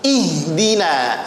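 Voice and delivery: Ihdina slowly with a pure i; clear i. Shown as a demonstration. Teacher models the word, i clear and slow. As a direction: open "Ihdina" on a true ee sound, drawn out. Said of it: "I bunyinya".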